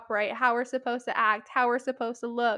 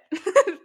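short laugh; 8 semitones higher, 7 dB louder than usual. Real, not acted. Laughing at own point.

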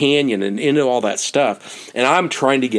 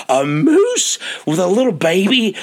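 retold wide-eyed amazement, pitched up; excited; 6 semitones higher than usual. Newcomer hears about the moose, excited. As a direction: echo it high, as an amazed question. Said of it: "And he was so excited".

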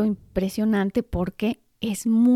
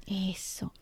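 susurro; 11 dB quieter than usual. Real, not acted. Comentario en voz baja.

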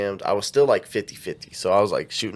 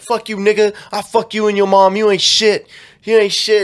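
high, loud retold insults; hammed-up angry tirade, pitched up; mock furious abuse; 9 semitones higher, 6 dB louder than usual. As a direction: imitate someone cursing out a friend. Acting out the abusive screenshots.

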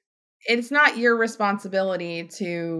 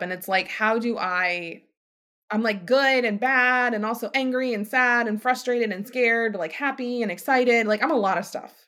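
ordinary talk, nothing marked

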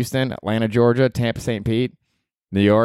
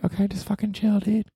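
higher, softer self-scolding; hushed calm-down to himself; 12 semitones higher, 5 dB quieter than usual. Acted. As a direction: talk yourself down. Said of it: "telling himself to calm down".

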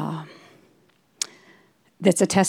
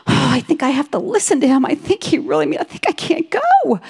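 upset resignation; 7 semitones higher than usual. Retold or imitated. Upset realization while driving.

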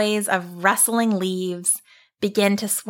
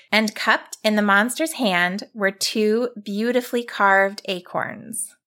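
plain reading voice

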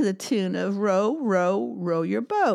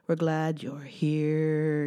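slow finish; 3 semitones lower, slower than usual. Pretend. Sung line slowed at the end.

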